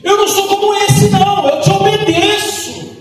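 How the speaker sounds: high, aggrieved complaint; angry older son. Acted. Older son complaining to his father, high and aggrieved. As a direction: list your grievances, rising in anger.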